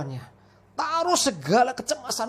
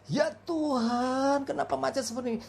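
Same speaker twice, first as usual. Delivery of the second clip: mock angry outburst; 6 semitones higher than usual. Stuck passenger complaining to God, mock angry. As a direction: retell his fury at the traffic jam, stretched out. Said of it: "dia marah luar biasa".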